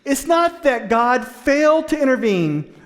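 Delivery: loud, high, building sermon delivery; full-force preaching. Real, not acted. Pastor pressing the congregation on God's role, loud and rising. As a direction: drive the point home from the pulpit.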